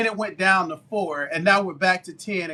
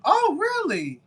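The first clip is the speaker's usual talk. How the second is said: surprised; 12 semitones higher, slower than usual. Real, not acted. Reacting to a chat comment.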